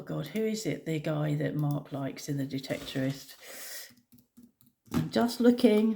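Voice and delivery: ordinary delivery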